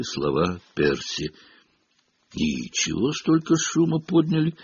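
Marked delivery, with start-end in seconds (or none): 2.3-4.5 higher-pitched earnest dismissal; self-important, serious character voice; quite serious. A narrator's reading. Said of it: "сказал он вполне серьёзно".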